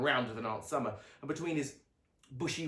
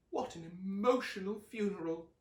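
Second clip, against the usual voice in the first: higher mother voice, declaring; Mum declaring; 7 semitones higher than usual. A narrator's reading. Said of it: "declared Mum".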